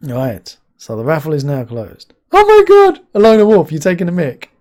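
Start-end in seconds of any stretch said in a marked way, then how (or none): 2.3-3.7 high, loud burst of disbelief; shocked shout. Real, not acted. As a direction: cry out his name in shock. Streamer shocked by troll.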